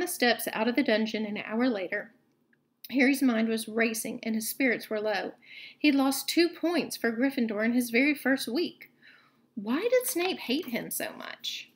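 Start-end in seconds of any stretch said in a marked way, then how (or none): none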